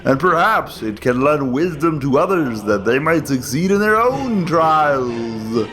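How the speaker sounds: ordinary delivery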